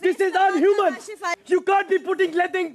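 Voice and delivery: high-pitched, outraged appeal; agitated protest. Real, not acted. Housemate appealing to Bigg Boss against bleach, high and agitated.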